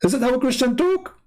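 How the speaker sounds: high-pitched mimicry of accusers; squeaky-high mock reproach with a questioning rise; mocking scolding critic. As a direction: imitate a scandalized critic, voice shooting up. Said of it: "they say".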